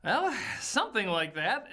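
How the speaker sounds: ordinary delivery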